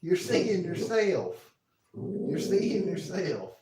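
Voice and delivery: high, drawn-out talk to a pet; slow, high sing-song coaxing; coaxing a dog. Real, not acted. Soothing dog barking at reflection.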